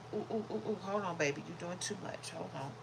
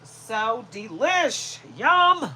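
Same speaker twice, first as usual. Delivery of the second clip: loud, drawn-out relish; savouring snack; 3 semitones higher, 11 dB louder, much slower than usual. Real, not acted. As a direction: stretch it out with big, loud delight.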